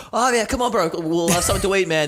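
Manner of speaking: a light Aussie ocker voice; lightly voiced Australian mate; broad Aussie. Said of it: "the most Aussie dude ever".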